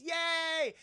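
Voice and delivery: high cheering yay; hammed-up cheer; mock cheer. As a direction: cheer like a proud mom. Mom cheers touchdown.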